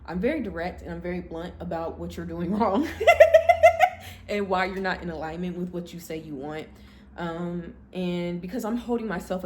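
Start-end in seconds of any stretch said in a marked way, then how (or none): 2.9-4.2 sudden loud squealing laugh; loud high laugh. Real, not acted. Laughing loudly at her own bluntness.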